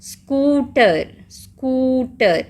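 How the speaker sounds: स्कूटर slowly, oo held; long oo. Shown as a demonstration. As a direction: stretch the first vowel of स्कूटर. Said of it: "double ओ sound लंबी है इसमें".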